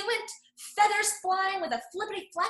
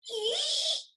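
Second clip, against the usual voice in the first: high, sharp squeal; pig squeal; 11 semitones higher than usual. Acted. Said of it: "squealed the pig".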